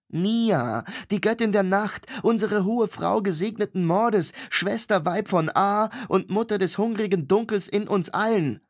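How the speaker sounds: solemn high-pitched recital of divine titles; solemn invocation. A narrator's reading. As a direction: invoke the goddess with ceremony. Mia invokes her goddess.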